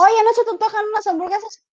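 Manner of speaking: agudo y rápido; esposo insistente imitado. Retold or imitated. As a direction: insiste animándola. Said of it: "ahorita ya es meme". (esposo ofreciendo hamburguesas, agudo y rápido)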